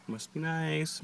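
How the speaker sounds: muttered aside, a little jealous; dry envious remark. Real, not acted. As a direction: say it under your breath, slightly envious. Teasing about sushi.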